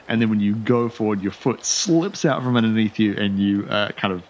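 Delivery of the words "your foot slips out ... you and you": ordinary talk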